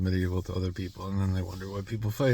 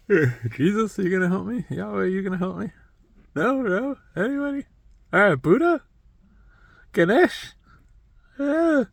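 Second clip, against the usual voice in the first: mock desperate plea; 11 semitones higher, 5 dB louder than usual. Retold atheist calling on gods.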